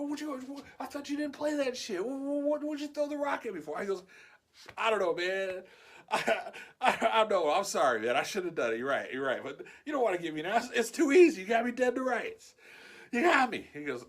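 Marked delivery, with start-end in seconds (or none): 0.0-3.8 incredulous demand. Retold or imitated. Angry confrontation after rock thrown. 4.8-13.7 laughing apology. Retold or imitated. George caught, laughing apologetically.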